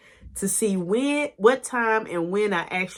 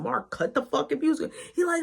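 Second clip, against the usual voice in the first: ordinary talk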